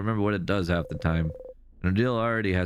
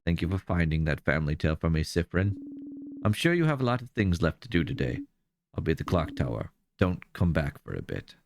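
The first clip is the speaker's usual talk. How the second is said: cold Odile; 2 semitones lower than usual. Acted. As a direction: dismiss him coolly. Odile's cold dismissal voiced.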